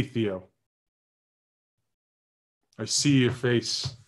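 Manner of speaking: normal talking voice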